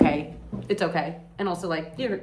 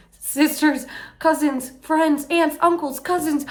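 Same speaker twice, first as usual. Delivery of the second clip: rising outrage; 10 semitones higher, 5 dB louder, slower than usual. Real, not acted. Listing victims, rising outrage.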